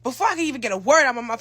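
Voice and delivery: ordinary talk, nothing marked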